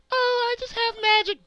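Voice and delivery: high, airy mock-innocent fairy voice; smug fairy. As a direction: play it off sweetly like it's nothing.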